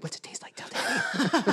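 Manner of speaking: hushed, voiceless coaxing of a pet; creepy-playful whispered question; conspiratorial whisper. Retold or imitated. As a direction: whisper it to the dog as a guilty secret. Jokingly asks dog about eating vomit.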